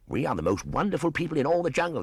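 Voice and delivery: high, fast, loud chattering boast; boastful monkey shout. Acted. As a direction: crow about yourselves like a mob of monkeys. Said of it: "they shouted".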